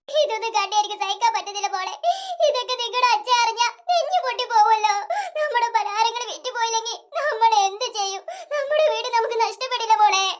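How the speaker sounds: low, crying voice that breaks on the words; a mother weeping over the failing shop; sobbing as she speaks to her daughter; tearful mother. Acted. Mother despairing, shop is empty.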